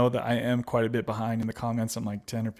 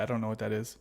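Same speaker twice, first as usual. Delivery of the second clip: ordinary talk, nothing marked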